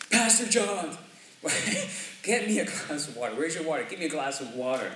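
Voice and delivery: put-on high, pushy child voice; demanding kid. Church member's child demanding water at night, high pushy voice. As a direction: whine for water like a needy kid.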